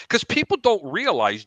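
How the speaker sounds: ordinary talk, nothing marked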